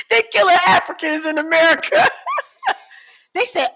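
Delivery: real laugh through the words. Real, not acted. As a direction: blurt it out laughing. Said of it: "laughing while telling".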